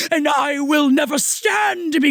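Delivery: high, theatrical indignant declaration; mock outrage. Imagined overreaction to being called kiddo, mock outrage.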